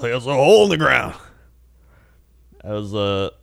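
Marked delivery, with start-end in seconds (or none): 0.1-1.1 put-on high, insistent old-man voice; adamant old man. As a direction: repeat the warning like a fussy old-timer. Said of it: "he's very adamant".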